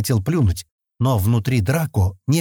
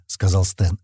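usual speaking voice

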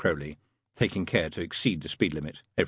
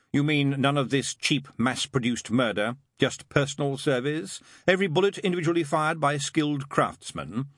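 higher, pointed bitter questioning; bitter sarcasm; 7 semitones higher, 3 dB louder than usual. A narrator's reading. Demon taunting the angel about political protest, bitter. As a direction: needle him with each phrase. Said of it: "said Crowley bitterly".

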